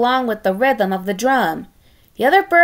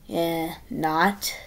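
softer dry sarcasm; sarcastic aside; 5 semitones lower, 7 dB quieter than usual. Acted. Narrator mocking the honor, soft dry sarcasm. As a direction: undercut it with a dry shrug. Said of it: "sarcastic narrator aside".

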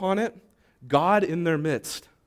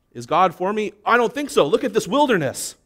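doubting complaint; 6 semitones higher, 5 dB louder than usual. Acted. Voicing a doubting believer.

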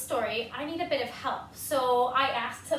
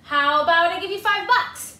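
pigeon bribing; 3 semitones higher, 9 dB louder than usual. Acted.

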